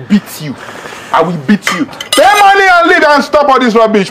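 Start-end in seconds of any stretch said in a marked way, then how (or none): 1.9-4.1 high, slow, forceful demand; angry demand. Acted. Angry waiter demanding the customer pay, high and slow. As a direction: spell it out angrily, pitch up.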